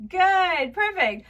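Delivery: high, loud teacher cheer; delighted praise. Pretend. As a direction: reward a little pupil with happy praise. Praising a pupil.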